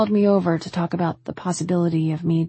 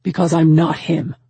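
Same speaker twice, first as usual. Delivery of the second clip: shouted; 6 dB louder than usual. A narrator's reading. Man refusing to be a substitute, shouting. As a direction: raise the voice and hammer it out. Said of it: "he shouted".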